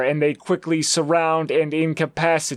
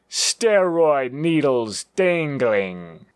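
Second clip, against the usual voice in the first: plain reading voice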